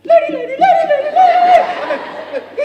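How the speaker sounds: shrieking the name high and very loud over and over; high ecstatic shouts; excited yelling. Retold or imitated. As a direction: scream it like an overjoyed kid who can't contain himself. Luther yelling excitedly in cafeteria.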